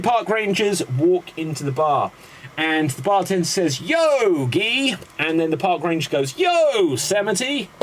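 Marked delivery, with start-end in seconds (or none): 3.8-5.0 high-pitched punning call-out; mock hailing. Bartender hailing in a pun joke. 6.4-7.7 high-pitched greeting for a pun; mock hailing. Ranger hailing back in joke.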